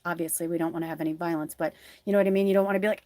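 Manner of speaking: ordinary delivery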